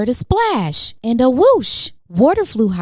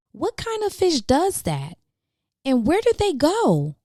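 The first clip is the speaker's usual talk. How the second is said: curious wondering; 7 semitones higher than usual. Acted. George wonders aloud.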